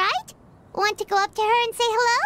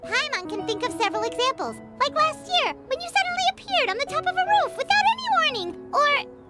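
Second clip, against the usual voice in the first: squeaky, climbing higher as it goes; incredulous teasing; 5 semitones higher than usual. Acted. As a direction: rattle off the evidence, building in pitch. Guide challenges adeptus with examples.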